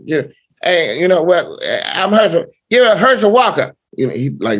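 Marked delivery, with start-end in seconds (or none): 0.6-3.7 high-pitched celebrity impression; Herschel Walker. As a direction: pitch it way up, imitating an odd voice. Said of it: "Herschel Walker talk like".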